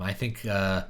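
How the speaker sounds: usual speaking voice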